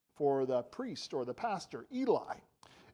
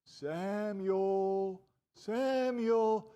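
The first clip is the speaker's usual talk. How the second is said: long drawn-out call, pitched higher; mysterious calling voice; 4 semitones higher, 3 dB louder, much slower than usual. Acted. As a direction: call the sleeping boy's name, stretching it out. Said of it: "he heard a voice say".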